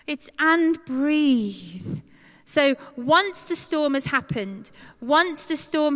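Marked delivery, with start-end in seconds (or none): 0.1-2.0 very slow, drawn-out "breathe"; slow and soothing. Shown as a demonstration. Showing the peace after the storm, slow and soothing. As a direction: stretch it out like a release of tension. Demonstrates the relaxed kind of peace.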